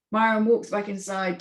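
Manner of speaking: ordinary delivery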